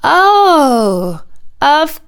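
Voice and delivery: loud, high, drawn-out swoop; dawning realisation. A narrator's reading. Prince finally understanding his aunt, in loud realisation. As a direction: stretch it out as the penny drops.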